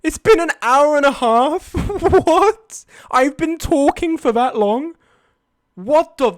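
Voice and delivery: high, loud disbelief; can't believe the time; shocked. Real, not acted. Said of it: "shock at stream length".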